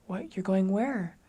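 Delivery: put-on high, surprised kid voice; imitated little boy. Little son asks parents where they are going, imitated puzzled child.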